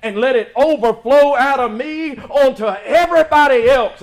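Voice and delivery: loud rhythmic preaching, punching each thank you; soaring sermon delivery; full-force preaching. Acted. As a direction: preach it out to the congregation. Building preaching climax on grace.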